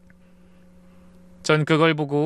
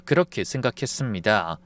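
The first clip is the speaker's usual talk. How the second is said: ordinary delivery, nothing marked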